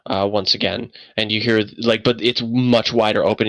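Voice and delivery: ordinary talk, nothing marked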